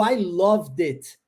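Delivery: "I loved it" slow, the d of "loved" joined onto "it"; linked d. Shown as a demonstration. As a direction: link loved and it, slowly. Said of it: "terminação do som de D". ("I loved it" ligando o D ao I, devagar)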